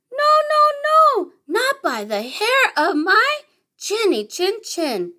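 high squeaky little pig, refusing. Acted. Little pig refusing the wolf at the door, squeaky and high.

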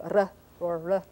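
"r or r" as two foreign R sounds; non-American R sounds. Shown as a demonstration. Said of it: "as opposed to".

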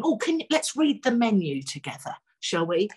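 ordinary talk, nothing marked

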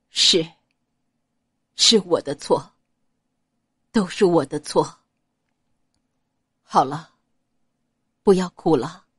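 calm her down gently; tender consoling. A narrator's reading. Xiao Chonghua soothing the crying Ouyang Nuan, low and tender.

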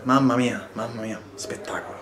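low, soft disbelief; awed murmur. Real, not acted. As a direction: breathe it out in awe. Amazed after near goal.

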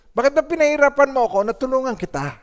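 high, fast, exasperated complaint; a touch of angry whining; mock frustrated complaint. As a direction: voice the resentful helper. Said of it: "nadarama ko na nagagalit ako".

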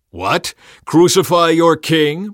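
loud, rising, drawn-out query; incredulous question. A narrator's reading. Pilate to the yelling crowd, incredulous. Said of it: "Pilate asked".

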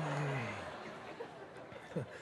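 quiet low chuckle; soft laugh. Real, not acted. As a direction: let a small laugh trail off. Joke about YouTube.

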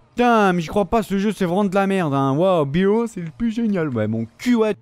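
sarcastic rage at the screen; angry yelling. Real, not acted. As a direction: blast the game with real anger. Raging at the game.

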